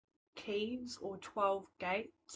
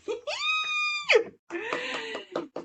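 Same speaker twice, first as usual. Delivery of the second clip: loud, very high squeal; celebratory squeal; 9 dB louder than usual. Real, not acted. Host cheering the giveaway winner, delighted squeal.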